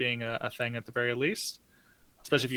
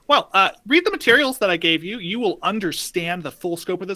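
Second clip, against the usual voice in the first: protester voice; 7 semitones higher, 7 dB louder than usual. Acted. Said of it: "and so they say".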